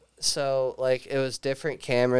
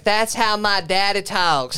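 slow, higher mock southern twang; southern accent imitation; 6 semitones higher, 4 dB louder, slower than usual. Retelling the southern woman answering the comedian, mock southern accent. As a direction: drawl it like a shy southern girl. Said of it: "She's like".

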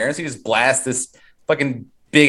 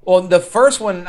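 normal talking voice

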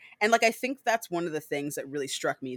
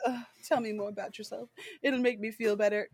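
a hint of a soft fake-sad Santiago; mock sad Santiago; 5 semitones higher, 3 dB quieter than usual. Santiago fakes sadness to play Louis, lightly voiced. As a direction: feign sorrow to play him. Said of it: "Santiago's like".

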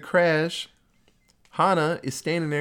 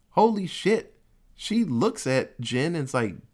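usual speaking voice

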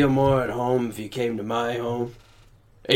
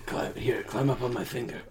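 hushed, breathy whisper; whispering on the phone; 10 dB quieter than usual. Acted. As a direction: whisper it close to the ear. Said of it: "I'm whispering directly into your ear".

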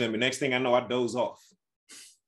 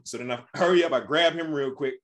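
ordinary delivery

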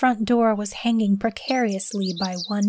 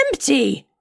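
sudden raised cry of discovery; 4 semitones higher than usual. A narrator's reading. Man opening his hands finds them empty, cries out. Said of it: "he cried".

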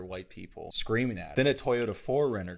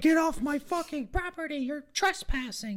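very high-pitched imitation scream; mock screaming; 4 dB louder than usual. Homeowner to a man in his driveway, mock screaming. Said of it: "just starts screaming at him".

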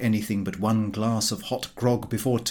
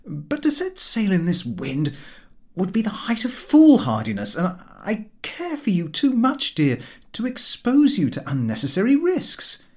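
earnest husband; 9 semitones higher than usual. A narrator's reading. Narrator voicing his tender excuse.

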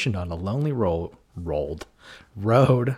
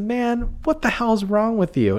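indignant; 12 semitones higher than usual. Retold or imitated. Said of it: "he said".

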